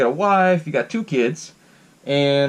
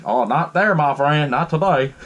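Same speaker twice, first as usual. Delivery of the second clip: put-on southern farmer accent. Neighbor picking up the baby, imitated southern farmer accent. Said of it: "he plays with a southern accent".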